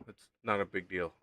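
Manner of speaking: ordinary delivery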